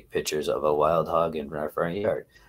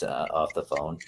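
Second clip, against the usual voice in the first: ordinary delivery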